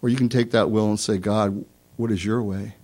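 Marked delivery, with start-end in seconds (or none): 1.2-2.8 soft, low humble asking; quiet prayerful question. Retold or imitated. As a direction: ask God softly.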